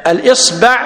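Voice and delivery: الإصبع said al-IS-ba', high and careful; fatha on ba. Shown as a demonstration. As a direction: model الإصبع with an a after the b. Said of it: "بفتح الباء هذا لغة".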